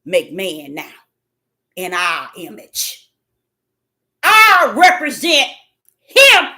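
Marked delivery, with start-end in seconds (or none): 0.0-3.1 voice of God. Retold or imitated. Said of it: "he said".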